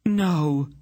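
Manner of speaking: high cry of loss. A narrator's reading. Aunt sees the smith lying still, crying out. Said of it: "she cried out".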